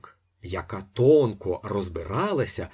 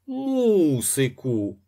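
put-on drawn-out, higher baby voice with pouted lips; playful toddler voice; 7 semitones higher, 4 dB louder, much slower than usual. A narrator's reading. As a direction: stretch it slowly like a mischievous little kid. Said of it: "голосом пустотливого карапуза протяг".

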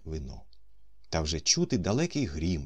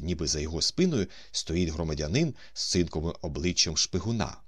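ordinary delivery, nothing marked

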